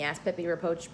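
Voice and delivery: plain reading voice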